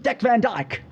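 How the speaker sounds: high-pitched, hammed-up Cockney impression; Cockney accent. Shown as a demonstration. Demonstrating accents for a friend, exaggerated Cockney accent. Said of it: "if you got the Cockney".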